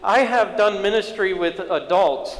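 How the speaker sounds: high, insistent sermon voice; impassioned preaching. Acted. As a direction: press the point home to the congregation. Preacher builds to the sermon's point.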